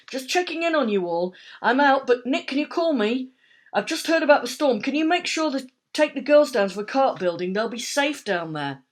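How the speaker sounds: anxious mother on the phone; phone message from a worried mum. A narrator's reading.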